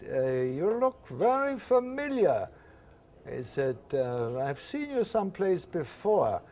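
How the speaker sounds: suspicious German officer, put on the German accent. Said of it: "he had his German accent on".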